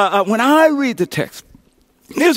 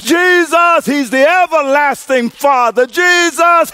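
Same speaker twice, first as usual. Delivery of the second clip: rising call and response; preaching at full force; 5 semitones higher than usual. Real, not acted. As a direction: whip the church up.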